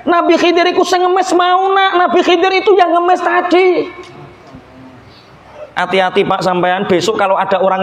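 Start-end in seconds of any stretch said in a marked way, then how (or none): 0.1-3.9 much higher, insistent reveal; emphatic revelation. Acted. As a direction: hammer home that the beggar was Khidir. Kiai reveals beggar was Khidir. (Kiai menegaskan kepada santri dengan nada jauh lebih tinggi)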